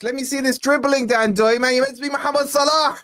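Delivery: high, fast shout at the player; real frustrated yell; exasperated shout. Real, not acted. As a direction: yell in disbelief at the winger. Yelling at a player.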